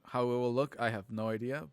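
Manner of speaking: usual speaking voice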